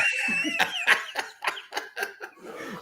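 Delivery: burst of laughter. Real, not acted. Big laugh after his own punchline.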